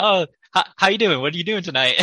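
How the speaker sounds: peppy barista again. Retold or imitated. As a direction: gush like a barista trying too hard. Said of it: "he's like".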